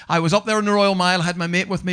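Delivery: normal talking voice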